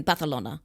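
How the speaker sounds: "Barcelona" with a lisped th for c; Castilian pronunciation. Shown as a demonstration. Podcaster adding an example, "Barcelona" with Castilian th. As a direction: say "Barcelona" with th in the middle. Mocks native pronunciation of place name.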